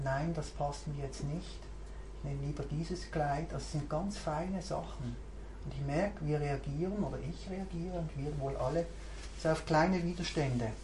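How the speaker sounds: ordinary talk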